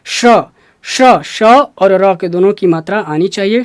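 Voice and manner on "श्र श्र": श्र said slowly with sh running into r; deliberate, separated श्र, श्र; pronunciation demo. Shown as a demonstration. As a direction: model श्र, both sh and r heard. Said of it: "फिर वही बोलना है".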